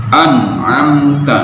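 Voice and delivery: an'amta with a throaty ain; slow an'amta, ain from the throat. Shown as a demonstration. (an'amta dengan huruf ain)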